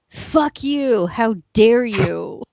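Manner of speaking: mock outrage, loud and drawn out; hammed-up indignant author, slowing on the last words; pissed-off Charles Dickens. Commentator voicing an outraged Dickens at a bad Scrooge, mock fury. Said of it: "Charles Dickens being pissed off".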